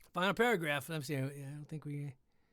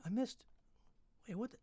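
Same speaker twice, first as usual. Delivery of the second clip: ordinary delivery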